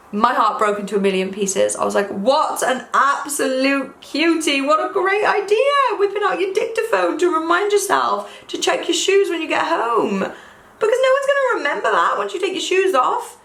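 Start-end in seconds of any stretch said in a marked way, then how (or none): 2.1-13.4 gushing. Retold or imitated. Gushing over the old man.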